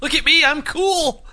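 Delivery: very high, slow preening voice; very high, slow mock cool dude; mock cool guy. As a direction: show off like a vain VJ, way up high and slow. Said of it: "mocking Carson Daly".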